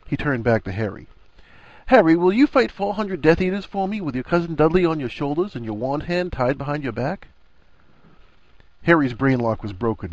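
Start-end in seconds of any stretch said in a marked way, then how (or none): 1.9-7.3 higher-pitched mock-earnest request; teasing challenge. A narrator's reading. Ron testing Harry with a silly favor, mock earnest. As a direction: pose an absurd dare in one run.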